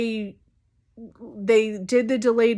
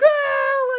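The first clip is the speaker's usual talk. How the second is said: mock yelp; 4 dB louder than usual. Mock yelp again, retold.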